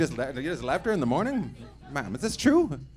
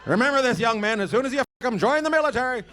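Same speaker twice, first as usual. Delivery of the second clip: higher, fast recruiting pitch; mock recruiter; 7 semitones higher than usual. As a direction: sell the military like a hammy recruiter.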